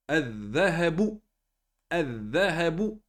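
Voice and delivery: "Al-Dhahabu" slowly as adh-dhahabu, l silent; marked shadda. Shown as a demonstration. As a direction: hold a double dh in "Al-Dhahabu", dropping the l. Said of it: "Les shadda, il faut bien les marquer". (« Al-Dhahabu » lent, dh redoublé, lam muet)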